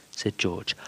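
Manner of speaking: ordinary delivery, nothing marked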